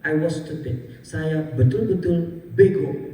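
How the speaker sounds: ordinary talk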